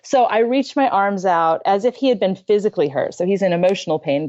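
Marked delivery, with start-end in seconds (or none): none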